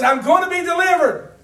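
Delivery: Paul exulting to the listeners; exultant cry. Acted. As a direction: burst out with certainty. Voicing Paul, loud take.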